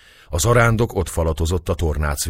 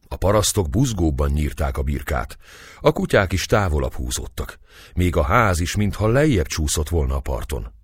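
usual speaking voice

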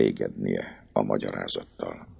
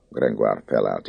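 ordinary delivery, nothing marked

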